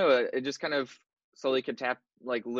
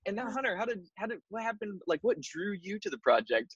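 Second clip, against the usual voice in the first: much higher, soft interviewer voice; mock interviewer voice; 9 semitones higher, 3 dB quieter than usual. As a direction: play the gushing host. Mimicking interviewer at roundtable.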